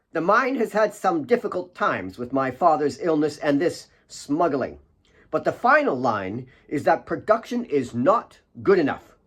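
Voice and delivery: scornful daughter driving it home, pitch climbing; shrill and bitter. A narrator's reading. Claire threatens the miners, building to shrill bitter scorn. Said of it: "It was slightly shrill and bitter".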